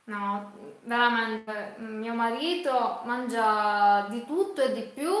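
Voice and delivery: usual speaking voice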